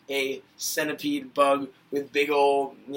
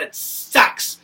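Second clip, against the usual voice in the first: harsh aside; 10 dB louder than usual. Real, not acted. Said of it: "ending the rant".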